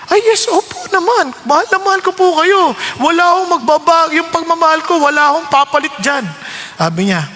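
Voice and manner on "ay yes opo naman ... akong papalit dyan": eager devotion. Retold or imitated. Eager answer to the Lord.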